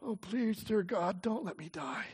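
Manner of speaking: faint whispered plea to God; fading desperate prayer. A narrator's reading. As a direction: pray it as your air runs out. Last prayer before blacking out.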